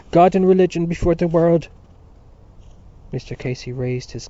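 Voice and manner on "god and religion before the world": higher, louder proclamation; impassioned cry. A narrator's reading. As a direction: proclaim it at full voice. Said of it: "Dante cried".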